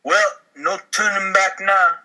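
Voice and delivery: usual speaking voice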